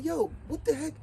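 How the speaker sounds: high, indignant disbelief; outraged. A narrator's reading. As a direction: farmer can't believe the ingratitude. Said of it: "And the farmer said".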